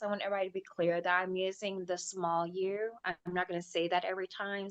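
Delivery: ordinary talk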